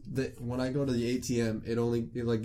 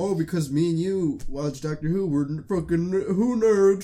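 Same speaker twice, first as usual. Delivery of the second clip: sneering, rising to a loud jeer; mocking; 4 semitones higher, 6 dB louder than usual. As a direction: scoff at the nerds. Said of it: "'cause people judge".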